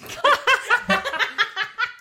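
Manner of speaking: high, loud burst of laughter; real burst of laughter at the end; big laugh. Real, not acted. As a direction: laugh out hard in relief. Laughing after ending.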